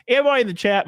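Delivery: ordinary talk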